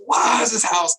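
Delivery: hammed-up exasperated dad; frustrated dad. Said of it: "he is frustrated".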